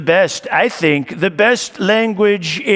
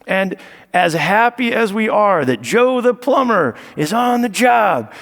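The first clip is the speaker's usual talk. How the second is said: ordinary delivery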